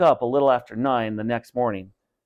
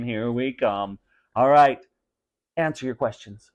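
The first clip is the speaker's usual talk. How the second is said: usual speaking voice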